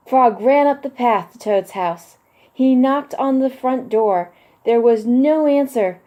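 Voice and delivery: put-on lively children's book narration; picture book read-aloud. A narrator's reading. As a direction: read it like bedtime story time for kids.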